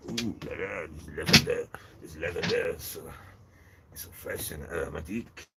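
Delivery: lightly voiced French fly, oohing over lavender; a hint of a French accent, swooning; mock French accent. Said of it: "It's probably a French fly".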